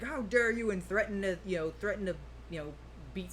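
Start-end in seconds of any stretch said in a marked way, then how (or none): 0.0-0.7 higher, mildly indignant quote; mock outrage. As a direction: imitate someone taking violent offence. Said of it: "get violent and go like".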